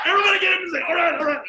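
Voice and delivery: loud, high excited orders; rally everyone to their places; urgent rallying. Retold or imitated. Retelling friends rushing to hiding places, urgent shouting.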